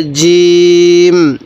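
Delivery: জিম with a hard j and very long vowel; teacher's firm, drawn-out demo of জিম; firm articulation. Shown as a demonstration. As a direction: hit the j firmly and stretch জিম into a hum. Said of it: "একটু শক্ত করে উচ্চারণ করব".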